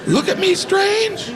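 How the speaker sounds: high, laughing, frantic inner monologue; panicked inner voice. Acted. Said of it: "In my mind".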